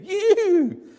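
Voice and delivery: put-on high, slow, wondering old woman's voice; high old lady in awe; amazed old lady. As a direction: marvel slowly like a grateful old lady. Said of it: "said one old lady".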